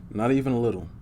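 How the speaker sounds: plain reading voice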